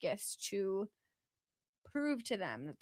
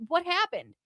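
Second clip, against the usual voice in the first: higher, louder retold bewilderment; acted-out confusion, pitched up; bewildered question; 8 semitones higher, 7 dB louder than usual. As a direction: ask it again with more alarm, acting it out. Acting confused waking from trance.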